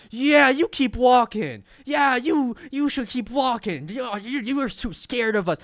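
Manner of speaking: high, loud jeering bro voice, slow at first; mocking taunt. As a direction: goad them like a cocky frat boy.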